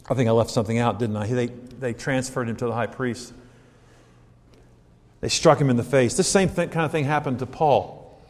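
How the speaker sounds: usual speaking voice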